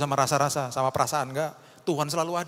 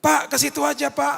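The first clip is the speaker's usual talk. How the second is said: high, loud insistent urging; urging congregant; 13 semitones higher, 5 dB louder than usual. Retold or imitated. Congregant insisting the pastor go there, high and loud. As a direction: urge the pastor to just go.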